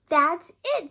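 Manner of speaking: put-on angry girl trying to whisper and failing; failed whisper. A narrator's reading. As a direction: sister losing her temper. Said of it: "trying to whisper".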